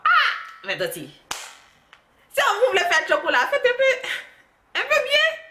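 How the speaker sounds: high squealing plea to an aunty, half laughing; mock scolding. To the bleached aunty on the bus, mock high scolding plea. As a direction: tease her with a shrill put-on voice.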